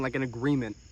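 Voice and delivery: ordinary talk, nothing marked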